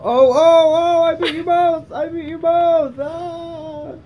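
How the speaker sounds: high, loud victory shout; triumphant shout. Real, not acted. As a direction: crow over the win. Wins against friends.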